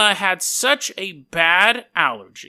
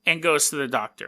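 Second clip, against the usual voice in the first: ordinary talk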